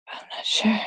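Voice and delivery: breathy unvoiced whisper; soft puzzled whisper. Real, not acted. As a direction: whisper it to yourself. Whispered aside.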